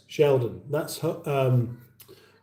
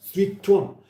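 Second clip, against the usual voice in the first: Citroen in exaggerated French, "seet-wan", pitched up; French accent; 5 semitones higher than usual. Shown as a demonstration. Host mocks the French way of saying it, French accent. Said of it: "Unless you're doing a French accent".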